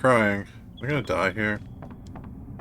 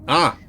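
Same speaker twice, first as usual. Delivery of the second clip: sneeze build-up; 6 semitones higher, 4 dB louder than usual. Acted. Character sneezing in game.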